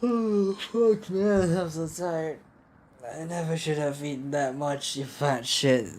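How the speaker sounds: high, put-on weary whine; exhausted character. Hungry character blaming themselves for eating, weary whine.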